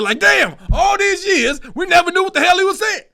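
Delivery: excited disbelief. Real, not acted. Telling viewers about the documentary, loud amazed shout. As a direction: shout it like you just found out.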